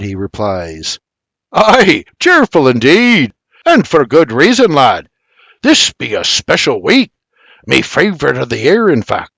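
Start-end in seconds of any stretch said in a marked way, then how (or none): 1.5-9.3 cheery Scottish burr, louder; cheerful brogue. Acted. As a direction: beam with a thick brogue. Scot explains his cheer.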